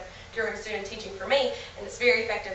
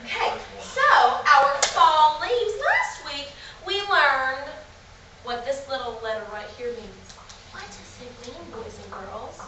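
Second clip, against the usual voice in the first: put-on kids show host; 2 semitones higher than usual. Shown as a demonstration. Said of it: "talking to you once again like the normal age".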